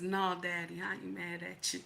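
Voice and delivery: low, very soft imitated reply; hushed pillow-talk reply. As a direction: play yourself softly giving in to him. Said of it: "then you hear those famous words".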